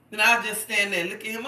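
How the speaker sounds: normal talking voice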